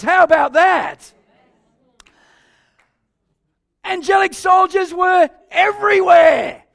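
loud, high, slow proclamation; triumphant build to a shout; excited preaching. Real, not acted. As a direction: thunder out the wonder of it. Preacher builds to the angel army.

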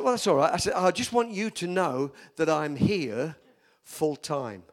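firm retort. Retold or imitated. New pastor answering the board member who opposed him, firmly. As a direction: announce it firmly, leaning on each phrase.

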